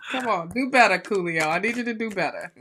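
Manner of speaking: usual speaking voice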